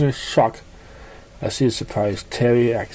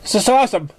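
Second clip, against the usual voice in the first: high, gleeful exclamation; delighted; 9 semitones higher, faster than usual. A narrator's reading. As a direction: squeal it happily. Pinkie delighted.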